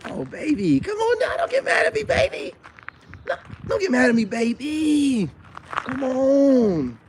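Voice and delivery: playful high coaxing to a pretty woman; flirty falsetto. Acted. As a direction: tease her out of being mad, up high. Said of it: "I'll be like".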